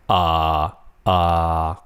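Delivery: UH held low and level; low flat tone. Shown as a demonstration. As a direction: keep UH down on one even low note. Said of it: "Third one is low flat".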